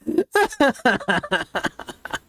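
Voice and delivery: long high laughing fit; real high, rolling laughter; cracking up. Real, not acted. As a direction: crack up at your own joke. Cracking up at own joke.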